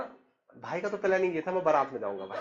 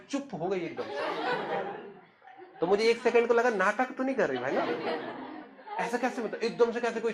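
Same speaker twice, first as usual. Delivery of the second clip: laughing disbelief; 5 semitones higher than usual. Real, not acted. Brother telling of his sister's sudden silence, laughing disbelief. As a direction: laugh through it in amused disbelief.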